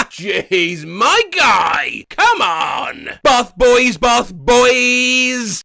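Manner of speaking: loud cheering shouts; cheering on the bath boys with loud shouts; hype shout. Acted. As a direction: yell it like cheering your mates on. Hyped shout at bath lads.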